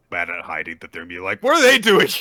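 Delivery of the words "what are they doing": high, loud mock exclamation; mock-shocked outcry. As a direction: blurt it out like a clumsy spy. Friends bad at hiding, voiced.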